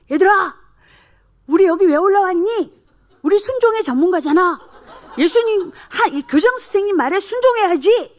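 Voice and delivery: put-on high, loud child rallying the others; rallying shout. Child urging classmates to obey the principal, rallying shout. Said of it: "이렇게 소리쳤어요".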